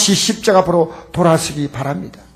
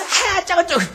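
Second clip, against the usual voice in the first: cackling laugh; 11 semitones higher, 4 dB quieter than usual. Retold or imitated. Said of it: "깔깔깔 웃으면서".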